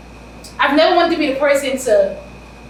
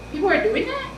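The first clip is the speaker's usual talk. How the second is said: surprised question; 12 semitones higher than usual. Real, not acted. Shocked at what people do.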